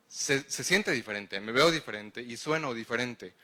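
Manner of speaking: usual speaking voice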